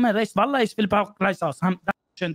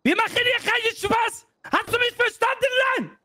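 much higher, slow, barked threat; aggressive interrogation; 11 semitones higher, slower than usual. Acted. Detective threatening the suspect in interrogation, aggressive shout. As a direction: lean in and shout the warning. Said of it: "Ich bin ein bisschen aggressiv".

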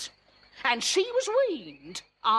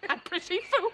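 laughing; 10 semitones higher than usual. Real, not acted. Nurse giggling through her story.

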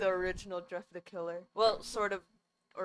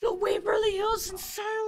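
high, loud excited blurt; 13 semitones higher, 8 dB louder than usual. Real, not acted. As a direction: shout the name out in delight. Excited guess after gasp.

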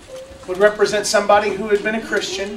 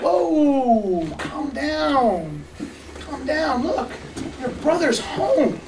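softer, gentle calming; soothing father; 3 semitones higher, 4 dB quieter, slower than usual. Acted. A father calming his angry older son, soft and gentle. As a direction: ease him down gently.

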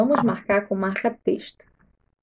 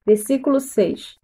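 ordinary talk, nothing marked

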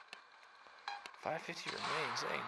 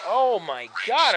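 exasperated oh my god; 7 semitones higher, 15 dB louder, much slower than usual. Real, not acted. Losing a match, exasperated.

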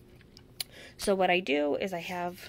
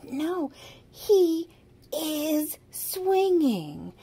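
"he" much higher and louder, "is swinging" very slow; stress on he; 8 semitones higher, 5 dB louder, much slower than usual. Shown as a demonstration. Teacher correcting a child's "him", stressing he. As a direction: correct by punching he. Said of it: "emphasizing what it is".

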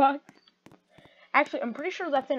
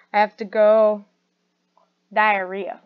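loud; 7 dB louder than usual. Acted. Excuse repeated, louder.

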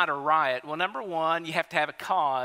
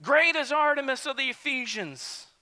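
raised high-pitched cry, read aloud; 9 semitones higher, 3 dB louder than usual. A narrator's reading. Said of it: "were enraged and were crying out".

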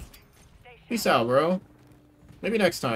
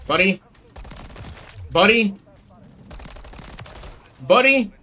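rising calls, louder and higher each time; calling out; 7 semitones higher, 3 dB louder than usual. Real, not acted. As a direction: call out, getting more urgent.

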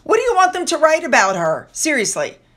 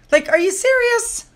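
incredulous; 10 semitones higher than usual. Real, not acted. Incredulous outburst at critics.

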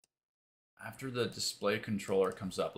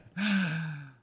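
chuckle; 9 semitones higher than usual. Real, not acted. Laughing at own joke.

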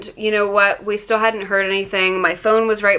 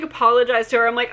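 usual speaking voice

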